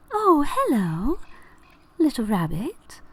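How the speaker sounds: high, slow, soft flower voice; sweetly curious flower character; talking flower. Acted. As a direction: greet a small creature in a drawn-out, lilting high voice. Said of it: "you hear one of the flowers say".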